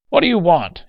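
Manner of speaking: high, loud, resentful demand; angry demand. Acted. Old man at the hospital desk, demanding angrily. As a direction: demand an answer, still full of rage. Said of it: "he demanded".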